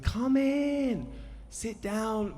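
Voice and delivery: very high, imitated American accent; David Lynch. Film director invites guests to sit, hammed-up American accent. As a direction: call it out in a high-pitched mock American voice. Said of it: "Sorry for my American accent".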